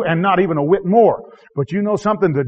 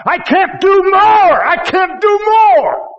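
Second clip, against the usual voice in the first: much higher, louder, slow shouted lament; desperate cry; 13 semitones higher, 7 dB louder, much slower than usual. Retold or imitated. Preacher voicing his plea to the Lord, slow shout. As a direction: cry it out at the end of your rope. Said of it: "sometimes I say".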